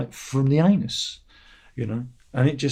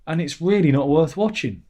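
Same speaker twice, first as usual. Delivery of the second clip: usual speaking voice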